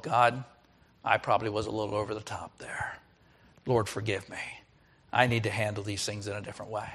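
low, hushed confession to God; soft, subdued admission; repentant prayer. Acted. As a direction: murmur a humble apology in prayer.

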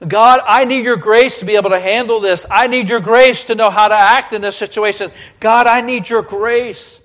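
high, forceful, insistent petition; urgent prayer. Acted. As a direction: cry out for help from the pulpit. Acted urgent prayer to God.

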